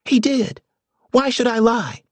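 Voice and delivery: put-on high, heated young woman's voice. A narrator's reading. Charlotte denies lying to her brother, high and heated. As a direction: defend yourself hotly against your brother. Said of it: "Charlotte asked heatedly".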